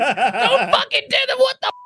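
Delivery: very high-pitched, laughing mock panic; mock outraged commenter. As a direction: imitate a freaked-out fan through laughter. Mocking angry replies to the threads.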